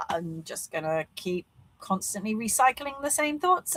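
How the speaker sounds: ordinary delivery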